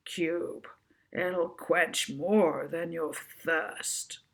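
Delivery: slow, weighty voiceover announcer; drawn-out voice-of-god ad tag; deep advert voiceover. Acted. Ad voiceover over the beach scene, slow and deep. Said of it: "a deep voice over the top of the scene".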